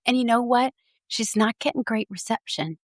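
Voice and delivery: ordinary talk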